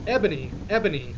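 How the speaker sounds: quick, mild mock call; lightly voiced sad calling; a touch of pleading; sad shout. A narrator's reading. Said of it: "shouted Draco sadly".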